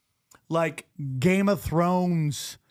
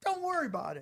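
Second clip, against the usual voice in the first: high, softer brush-off; dismissive squatter; 10 semitones higher, 8 dB quieter, faster than usual. Retold or imitated. Squatters brushing off a question about the pyramids, high dismissive mock voice. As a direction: wave it away in a high, quieter voice.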